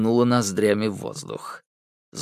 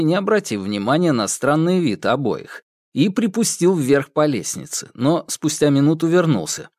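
ordinary delivery

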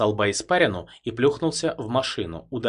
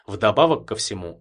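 usual speaking voice

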